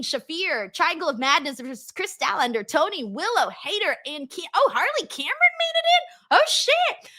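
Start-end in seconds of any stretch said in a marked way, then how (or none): 4.6-7.1 sudden high squeal of surprise, much higher; real astonished outburst; shocked surprise. Real, not acted. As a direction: caught off guard, voice jumps up high. Surprised by a name on list.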